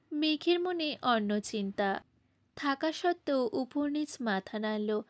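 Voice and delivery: ordinary delivery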